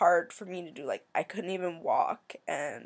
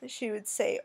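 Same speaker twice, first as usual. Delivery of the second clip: ordinary delivery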